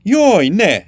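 high, loud anguished cry; howled cry. A narrator's reading. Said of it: "üvöltötte a királyfi". (magas, hangos, kétségbeesett kiáltás)

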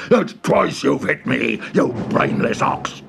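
slow angry ogre; furious ogre. Acted. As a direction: growl it slowly in fury. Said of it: "With a furious grunt".

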